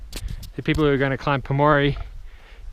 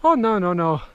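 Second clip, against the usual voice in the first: a hint of a Nepali guide's accent, raised; lightly put-on Nepali accent, higher; mock guide accent; 6 semitones higher, 4 dB louder than usual. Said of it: "I don't even know why I did that accent".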